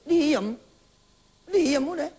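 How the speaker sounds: stern hush. Acted. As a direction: shut the child down briskly.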